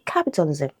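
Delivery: "capitalism" stressed on CAP; first-syllable stress. Shown as a demonstration. Teacher showing suffix keeps root stress, first-syllable stress. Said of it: "first syllable".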